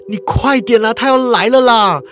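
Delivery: high, loud, desperate crying plea; terrified kid shouting at sister; panicked child. Retold or imitated. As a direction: stamp your foot and beg your sister to hurry. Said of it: "我就着急的跺脚说".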